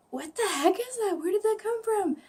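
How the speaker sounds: a real double take at your own sound; amazed. Real, not acted.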